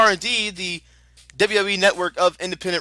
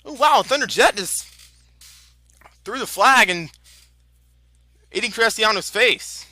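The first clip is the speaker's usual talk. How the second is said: excited; 3 semitones higher than usual. Real, not acted. Excited call of live wrestling action.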